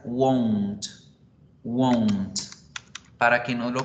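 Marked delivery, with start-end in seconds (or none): 0.0-2.4 "won't" with a rounded "wo" and clipped end; clear won't. Shown as a demonstration. Teacher modelling "won't" so students don't confuse it. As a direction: repeat "won't" deliberately. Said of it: "la pronunciación es esta".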